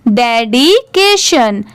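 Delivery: डेडिकेशन drawn out syllable by syllable; slow model pronunciation. Shown as a demonstration. Teacher asking learners to repeat after her, डेडिकेशन slowly split. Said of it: "मेरे बाद repeat करिए इस word को".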